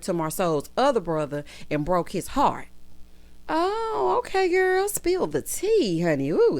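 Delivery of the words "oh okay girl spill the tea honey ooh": high, drawn-out "spill the tea"; gossipy delight. Real, not acted. Hearing the juicy reveal, delighted and gossipy. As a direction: savor the gossip.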